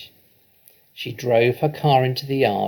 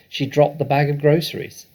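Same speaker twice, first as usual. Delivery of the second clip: usual speaking voice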